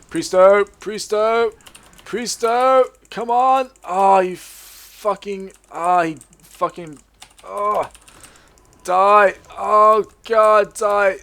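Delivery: high, loud, desperate urging; frantic gamer yelling. Real, not acted. Pleading and cursing during fight.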